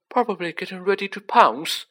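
high scared voice; jittery and high. Acted. Ron frightened in dark.

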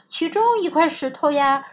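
ordinary delivery

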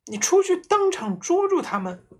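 high, loud and fast frightened plea; scared wife urging husband; trembling urging. A narrator's reading. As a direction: push him out in panic. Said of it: "妈妈还是颤抖着说".

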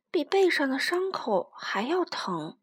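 usual speaking voice

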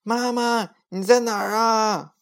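high, loud call for mom. A narrator's reading. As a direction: shout for mom while running. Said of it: "一边跑一边叫".

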